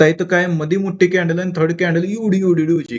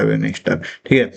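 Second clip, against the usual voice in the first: ordinary delivery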